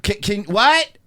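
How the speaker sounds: loud, high comic calling out; Homer quote shouted. Acted. Host playing Homer on the phone in a towel, shouting. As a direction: shout as if hard of hearing.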